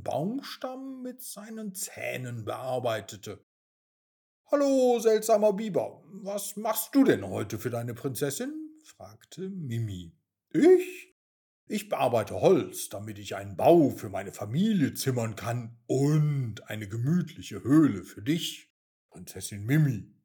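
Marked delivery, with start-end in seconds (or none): 4.5-8.8 louder, quick, cheeky greeting; cheeky princess. Acted. Mimi greets the beaver. 10.5-20.1 lower, louder working beaver; a sturdy beaver answering proudly. Acted.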